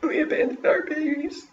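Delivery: high, tearful wail; crying mother. A narrator's reading. Mother crying for the babies she abandoned, high and tearful. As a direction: break down over the lost children. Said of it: "Sam's mother just starts crying".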